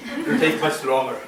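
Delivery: ordinary delivery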